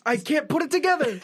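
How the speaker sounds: fast, high mock confused dad.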